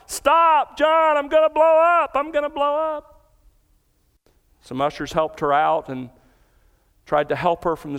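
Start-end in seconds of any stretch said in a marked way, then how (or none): 0.1-3.3 high, loud, terrified shriek; retelling her high, loud, panicked scream. Retold or imitated. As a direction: scream it like she's losing control. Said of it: "she screamed out".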